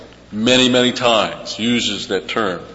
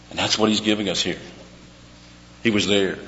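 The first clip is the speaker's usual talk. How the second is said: usual speaking voice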